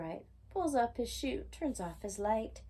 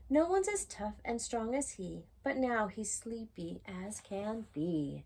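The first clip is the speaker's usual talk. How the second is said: ordinary delivery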